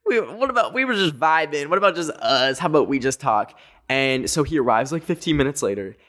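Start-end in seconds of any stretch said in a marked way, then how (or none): 0.0-3.6 high, whiny pleading. Retold or imitated. To the assistant, a high whiny plea. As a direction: beg to keep it between you two. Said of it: "and I'm like".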